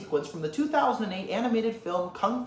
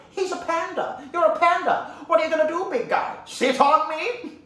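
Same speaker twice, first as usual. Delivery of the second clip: high, loud, incredulous scoffing; taunting, with a scornful laugh; mocking disbelief; 10 semitones higher, 4 dB louder than usual. Villain mocks his panda opponent, incredulous and loud.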